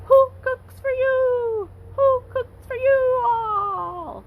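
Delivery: high, slow sing-song hoot imitating a barred owl; hooting owl call. Teacher imitating the barred owl's call for kids, high drawn-out hoot. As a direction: hoot it out like the owl calling through the woods. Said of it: "the call sounds like the owl is saying".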